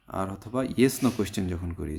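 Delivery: normal talking voice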